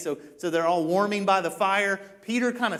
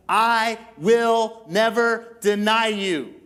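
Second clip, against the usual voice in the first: loud, slow, higher declaration; emphatic vow; 5 semitones higher, 6 dB louder, much slower than usual. Acted. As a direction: hammer out each word as a pledge. Peter vows loyalty to Jesus.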